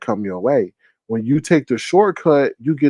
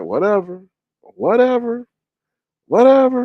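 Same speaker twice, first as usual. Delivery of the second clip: dismissive whatever; 9 semitones higher than usual. Retold or imitated. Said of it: "But I'm like".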